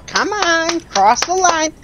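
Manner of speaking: high bossy teacher call; school teacher voice. Pretend. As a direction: urge them on like a teacher. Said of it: "I sound like a freaking school teacher".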